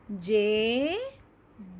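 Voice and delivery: जेब stretched long, je-eb; very slow model reading. Shown as a demonstration. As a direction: draw जेब out for the children. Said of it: "धीरे धीरे सही उच्चारण".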